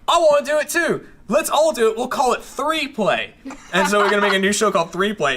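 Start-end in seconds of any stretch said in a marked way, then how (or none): 0.1-3.3 high-pitched, eager impression of a friend; Gavin. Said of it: "Gavin comes in and he goes".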